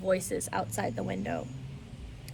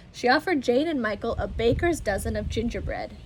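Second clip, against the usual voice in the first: ordinary delivery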